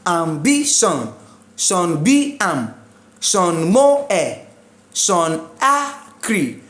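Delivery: slow, split syllables, Ambition then Sean B am; deliberate syllable-by-syllable Sean Mo e; counting syllables backwards. Shown as a demonstration. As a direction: lay out each syllable separately from the last, as in Sean Akri. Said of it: "start counting from the last syllable".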